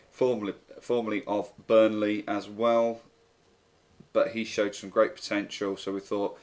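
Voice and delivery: normal talking voice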